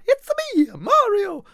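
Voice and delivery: very high bad Italian accent; cartoonish Italian accent, squeaky and loud; Mario. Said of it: "a bad Italian accent is the emphasis".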